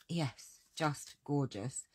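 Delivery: ordinary delivery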